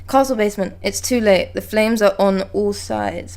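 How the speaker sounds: drawn-out, stiff reading; slow clumsy read. Shown as a demonstration. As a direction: read it slowly and awkwardly. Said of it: "Why did I read that like an idiot?".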